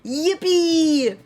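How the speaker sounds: a high, loud squeal of joy; character cheer. Acted. GM opens the session with a character's gleeful whoop. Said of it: "five different voices for today".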